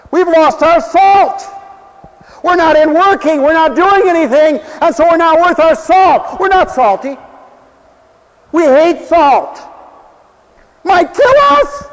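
high, loud, slow preacher's cry; fervent preaching. Acted. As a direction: hammer the message home like a revival preacher. Preaching at full force.

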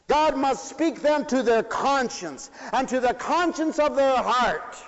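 high, loud, ringing sermon; preaching at full force. Acted. Preacher to congregation, preaching at full force.

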